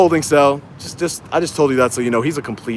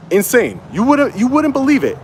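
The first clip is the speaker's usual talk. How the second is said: incredulous; 10 semitones higher than usual. Real, not acted. Incredulous reaction to his arrest.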